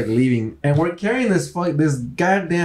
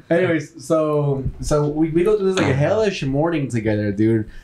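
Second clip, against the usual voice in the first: ordinary delivery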